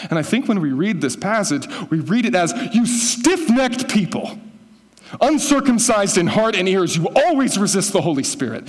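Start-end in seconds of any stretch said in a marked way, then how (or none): 2.7-8.6 higher, louder harsh condemnation; furious preacher condemning the Sanhedrin; condemning rant. Shown as a demonstration. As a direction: rant with spit flying. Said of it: "I think I read it like that".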